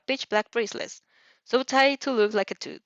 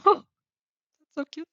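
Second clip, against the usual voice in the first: delighted laugh; 8 semitones higher than usual. Real, not acted. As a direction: giggle at the cute moment. Reader reacts to cute scene.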